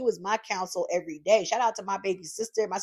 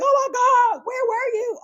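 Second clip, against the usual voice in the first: high squeal of fans greeting her; hammed-up fan excitement; excited fans; 6 dB louder than usual. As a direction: squeal like delighted followers. Fans' reaction voiced.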